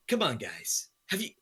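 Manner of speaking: high-pitched, hammed-up boastful celebrity impression; Trump Cruise. Podcaster parodying an overconfident politician boasting about tennis, hammed-up impression. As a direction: brag in a mock politician-meets-film-star voice. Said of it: "my Trump Cruise impression".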